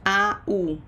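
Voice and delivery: ordinary talk, nothing marked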